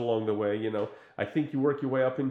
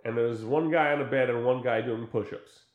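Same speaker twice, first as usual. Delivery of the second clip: normal talking voice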